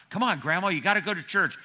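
high, loud granddaughter's urging; insist, refusing to give up; persistent pleading. Retold or imitated. Granddaughter begging Grandma to go to church, persistent. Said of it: "Granddaughter was really persistent".

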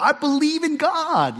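high, slow mock claim of faith; imitating a believer. Preacher voicing a churchgoer, high and slow. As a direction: play the listener who insists they believe. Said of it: "you might say".

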